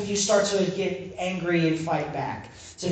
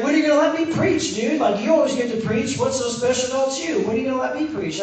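high-pitched playful nagging; imitated joking pestering; 6 semitones higher, faster than usual. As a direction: pester like a jokey kid begging a turn. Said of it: "he's kind of joking with me".

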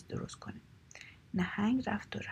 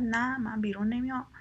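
raised, louder storyteller's cry; defiant shout; 3 semitones higher, 6 dB louder than usual. A narrator's reading. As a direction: shout back a firm refusal, moderately strong. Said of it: "از ته شکم نهنگ فریاد زد". (فریاد مخالفت، کمی نمایشی)

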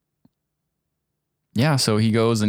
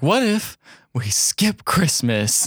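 scheming husband; 5 semitones higher, slower than usual. Retold or imitated. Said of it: "he's like".

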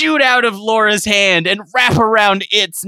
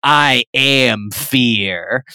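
low, slow villain boast; scoffing villain; 6 semitones lower, much slower than usual. Acted. Romney scoffing at the laptop, low slow boast. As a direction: growl it low and slow like Bane. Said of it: "Mitt Romney scoffs and goes".

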